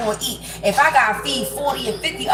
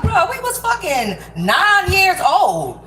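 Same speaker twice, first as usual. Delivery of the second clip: higher, slow, drawn-out disbelief; exasperated protest; 7 semitones higher, slower than usual. Retold or imitated. Answering a jealous friend's old accusation, slow exasperated protest. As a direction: stretch it out like the charge is absurd.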